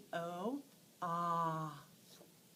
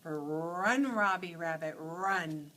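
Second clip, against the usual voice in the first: cheering on; 3 semitones lower, 6 dB louder, much slower than usual. Acted. Urging rabbit across road.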